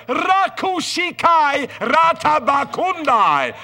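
very high, loud, slow tongues outburst; imitating the bishop's loud, ecstatic tongues; speaking in tongues. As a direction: belt it out like a preacher on a tabletop.

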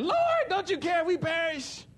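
high, loud, laughing panicked cry; mock panic. Preacher voicing the disciples in the storm, high panicked cry.

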